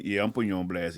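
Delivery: normal talking voice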